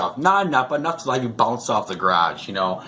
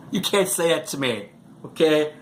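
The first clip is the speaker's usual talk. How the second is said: ordinary talk, nothing marked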